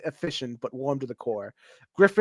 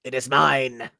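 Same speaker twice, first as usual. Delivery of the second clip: warlord shout; 8 dB louder than usual. Acted. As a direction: bark the demand at them.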